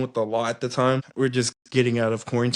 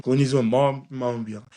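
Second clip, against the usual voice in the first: ordinary talk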